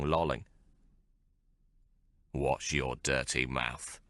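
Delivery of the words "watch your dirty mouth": low growl. Acted. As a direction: lower and slow, a threatening growl. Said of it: "I growled".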